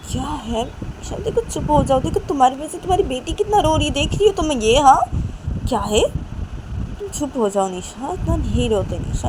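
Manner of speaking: acted pleading reproach to a crying daughter; exasperated mother. A narrator's reading. Mother telling Nisha to stop crying, exasperated.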